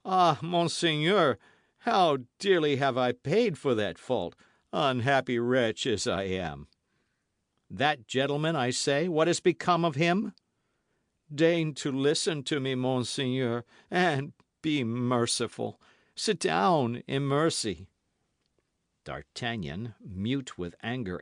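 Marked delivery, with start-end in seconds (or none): 0.0-6.7 loud, high pitiable moan; wailing lament. A narrator's reading. Host bemoaning his fault to D'Artagnan, pitiable wail. 7.7-10.3 loud, higher demand; insistent demand. A narrator's reading. D'Artagnan pressing the host, loud insistent demand. 11.3-17.9 loud, high begging; pleading host. A narrator's reading. Trembling host begging D'Artagnan, pleading.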